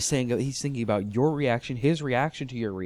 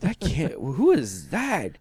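higher, slow stunned disbelief; amazed; 5 semitones higher, slower than usual. Retold or imitated. Hearing the actress on a promo, stunned. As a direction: drag out who is that in awe. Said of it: "and I'm like".

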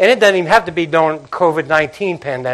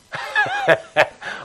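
high laugh; 4 dB quieter than usual. Real, not acted. Laughs at Jesus's question.

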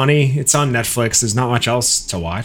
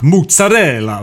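mock Italian accent, suddenly higher and louder; 7 semitones higher, 5 dB louder than usual. Waiter at an Italian restaurant suddenly switching, exaggerated Italian accent. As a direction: burst into an exaggerated Italian pronunciation. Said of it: "go from talking like me".

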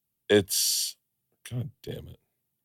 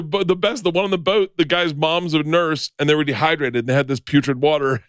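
ordinary talk, nothing marked

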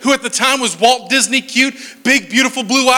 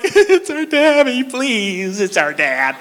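high, drawn-out whimper sinking down; hammed-up kid on the verge of tears; mock tearful plea; 3 semitones higher, much slower than usual. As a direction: plead like a child about to cry. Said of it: "this far away from tears".

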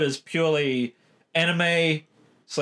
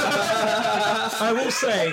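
high laughing giggle; 6 semitones higher, much slower than usual. Real, not acted. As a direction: laugh through the words. Laughing at friend's joke.

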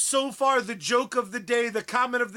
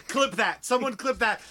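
normal talking voice

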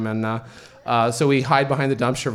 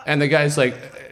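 ordinary delivery

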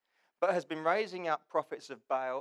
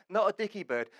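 normal talking voice

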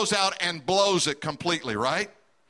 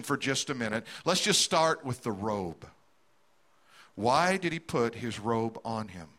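much lower, softer and slower, drawing the story out; slow right down and lower the voice; slow motion; 9 semitones lower, 4 dB quieter than usual. Real, not acted. Preacher slowing the prodigal story for the congregation, hushed and slow. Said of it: "put that story in slow motion".

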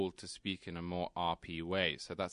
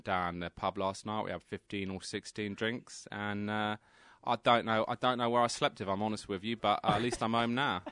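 London accent. Shown as a demonstration. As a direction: tell it like a Londoner the morning after the pub. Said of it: "And can you do a London accent?".

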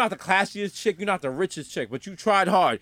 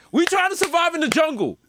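preachy rant; 6 semitones higher than usual. Retold or imitated. Telling plane crash survivors to stop praying, mildly raised rant. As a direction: lecture the survivors about praying. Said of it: "I was like".

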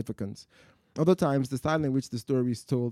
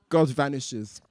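usual speaking voice

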